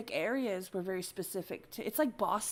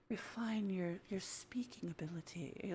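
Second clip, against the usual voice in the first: imitating a whisper; 9 dB quieter, slower than usual. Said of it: "she talked like this".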